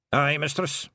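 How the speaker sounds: lightly voiced Scots pixie; a touch of wee Scottish flier; Hamish the Feegle aviator. Hamish answering Tiffany, lightly voiced pixie.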